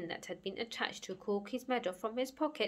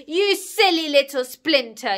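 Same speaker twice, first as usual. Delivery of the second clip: very loud, raised angry growl; growled insult; 6 semitones higher, 15 dB louder than usual. Acted. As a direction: growl the insult at full volume. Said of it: "he growled".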